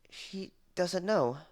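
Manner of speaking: high, slow frightened whimper. Acted. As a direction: let it come out slowly and scared. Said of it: "Glory whimpered".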